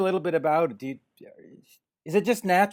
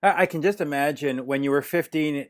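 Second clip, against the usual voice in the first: normal talking voice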